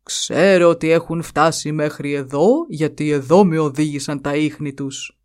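a hint of a gruff buffalo sure of himself; buffalo chief. A narrator's reading. Buffalo chief keeps questioning.

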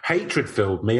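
normal talking voice

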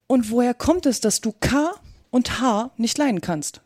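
ordinary delivery

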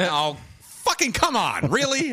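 high, drawn-out come on; exasperated disbelief. Retold or imitated. Viewer reacting to the big reveal in high exasperated disbelief. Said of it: "And I was like".

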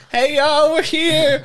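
high, cheery mock greeting; chirpy newcomer. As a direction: greet like a clueless visitor. Voicing arriving English ships.

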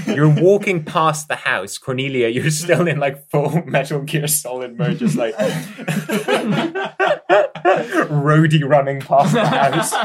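loud, high, breaking into real laughter; laughing narration. Real, not acted. Game master describing a botched sneak past the house, laughing. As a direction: narrate while cracking up.

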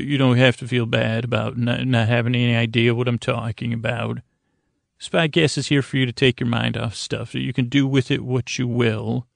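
normal talking voice

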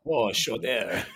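slow, drawn-out "chow-dah"; mock Boston accent. Joining the pronunciation joke, slow mock Boston accent. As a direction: stretch it out, Boston style. Joins the joking accent repeats.

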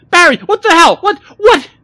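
very high, loud panicked cry; horrified, near sobbing. A narrator's reading. Millie backs away from Barry, horrified and shrieking. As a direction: shriek it, backing away aghast. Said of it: "She started sobbing and backed away from him".

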